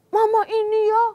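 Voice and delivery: loud, high mock yelling; a child shouting at mother; hammed-up bratty yell; child snapping at mom. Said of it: "bentak-bentak".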